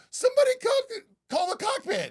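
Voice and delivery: high, loud imitation of a panicking passenger; mock panicked scream. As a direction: shriek it like a terrified passenger, exaggerated. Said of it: "Did you hear some of the screams in there?".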